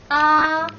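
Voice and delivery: AH sung out long on one high, level pitch. Shown as a demonstration. Teacher models first tone for the class, high and level. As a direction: hold AH high and perfectly even. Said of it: "Quick review".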